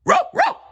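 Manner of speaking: urgent cartoon dog barks; dog barking. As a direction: yap like an excited little dog. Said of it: "Pluto barked".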